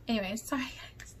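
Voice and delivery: fast embarrassed whisper; whispered sorry aside; quick whisper. Real, not acted. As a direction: hurry the apology under your breath. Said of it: "why am I such a tea right now".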